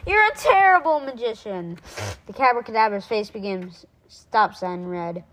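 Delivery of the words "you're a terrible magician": raised, louder heckle; taunting shout. A narrator's reading. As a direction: call out the insult at the monster. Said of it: "shouted from a teacup".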